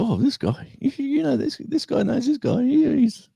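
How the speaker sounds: mock the coworkers gushing about him; impressed coworkers. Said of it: "he said".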